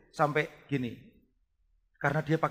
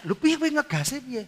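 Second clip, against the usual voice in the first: surprised question; 11 semitones higher, 5 dB louder than usual. Retold or imitated. Pastor quoting himself asking student.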